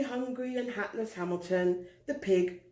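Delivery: ordinary delivery, nothing marked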